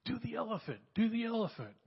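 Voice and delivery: put-on high-pitched kids' voice, begging for the elephant; mock little boys pleading; little kids asking. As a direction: play the excited kids calling out a request. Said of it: "these three little guys say".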